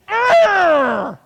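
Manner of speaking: high, loud mock growl of frustration; mock frustrated growl. Pastor imitating his frustration at wife's spills, loud mock growl. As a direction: let out a hammed-up frustrated roar.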